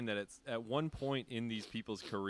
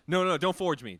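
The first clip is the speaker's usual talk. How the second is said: mock protest; 7 semitones higher, 12 dB louder than usual.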